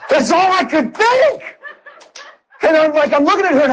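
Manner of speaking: delighted laughter; laughing. Real, not acted. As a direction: laugh through it.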